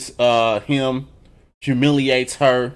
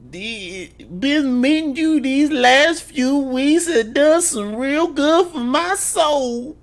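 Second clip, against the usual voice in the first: put-on high, slow, sing-song old church lady; mock churchy mama; much slower than usual. Mocking the mama's pious words to Bumpy's friend, high sing-song. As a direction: ham up a pious mama, drawn out.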